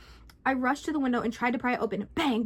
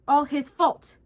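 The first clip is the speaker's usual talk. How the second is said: angry; 3 semitones higher, 8 dB louder, much slower than usual. Acted. Man mutters angrily at the safe.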